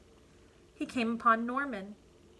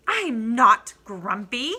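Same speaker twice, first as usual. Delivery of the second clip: insisting; 9 dB louder, slower than usual. Acted. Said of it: "insisted Jim".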